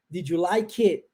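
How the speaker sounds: "Did you like it?" with the t of "it" dropped, "did you" run together as didju; swallowed final T. Shown as a demonstration. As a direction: eat the final T of "it". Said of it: "Eu engulo o T". (engolindo o T final de "it")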